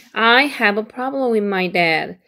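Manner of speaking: very slow, each word clear; slow model sentence; slow careful read. Shown as a demonstration. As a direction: read it slowly for learners. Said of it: "나는 문제가 있어".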